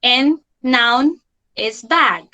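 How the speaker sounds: ordinary talk, nothing marked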